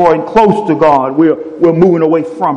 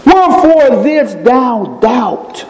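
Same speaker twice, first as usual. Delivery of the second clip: slow, solemn reading of Jesus' words; Jesus' rebuke; 7 semitones higher, much slower than usual. Acted. Jesus to Peter sinking in the water, slow and solemn. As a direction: draw it out slowly, a gentle rebuke. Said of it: "called him and said unto him".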